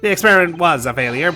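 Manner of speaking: put-on an excitable professor with a weak German accent; Harebrain German accent. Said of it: "I can't do a German accent".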